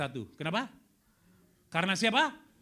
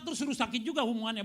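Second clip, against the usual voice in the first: normal talking voice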